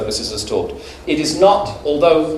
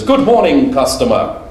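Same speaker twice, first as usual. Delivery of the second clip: a British shopkeeper chirping good morning to a customer; cheery shopkeeper greeting; 2 semitones higher, 4 dB louder than usual. Retold or imitated. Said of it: "the shopkeeper says".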